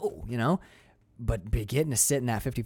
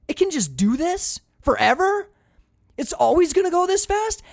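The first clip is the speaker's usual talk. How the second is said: high, louder, wide-eyed wonder; amazed disbelief; 5 dB louder than usual. Retold or imitated. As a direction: marvel at the speed. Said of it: "He's like".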